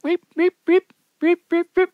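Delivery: imitated zapping in short, high beeps; high electric-shock beeps. Storyteller imitating Doug on a live light socket, high beeps.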